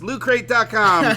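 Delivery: loud, high ad punchline; ad tagline. Acted. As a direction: announce it like a sponsor. Parody ad tagline.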